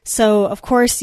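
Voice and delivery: usual speaking voice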